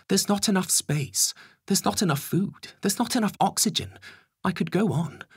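high, urgent Home Secretary voice. Acted. Home Secretary explaining the shortages to the Prime Minister, high and urgent.

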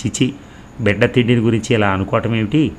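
usual speaking voice

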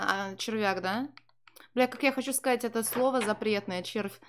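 normal talking voice

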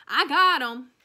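loud, high shout. A narrator's reading. Sweetie Pie shouting that she saved the pictures, loud. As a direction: call it out proudly. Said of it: "she kept shouting".

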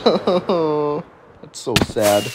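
very slow fake sob; hammed-up weepy dismay; mock crying. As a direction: whimper it out, drawn out. Mock sobbing about the desert.

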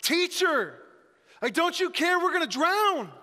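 high, loud panicked cry to wake him; shouting. Acted. Disciples waking Jesus in the storm, shouting. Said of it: "woke him up shouting".

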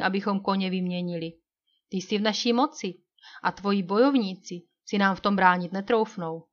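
plain reading voice

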